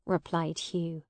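usual speaking voice

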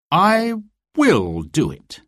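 firm, slow determined promise; Robin's vow. A narrator's reading. Robin vows to the reader, firm and slow. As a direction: hero swearing he'll do it. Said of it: "he said".

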